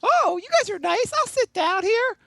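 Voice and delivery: mock a girl in high falsetto; girl at table. Girl accepting a seat from the boys, high mock falsetto.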